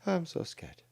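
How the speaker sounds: soft, high, put-on scared voice; mock fear. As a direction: fake being frightened, small and high. Sarcastic fake fear at the video.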